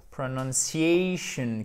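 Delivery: "Pronunciation" said slowly in English, long stressed "a". Shown as a demonstration. Teacher showing learners the English word, "Pronunciation" slowly articulated. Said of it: "pour la prononciation".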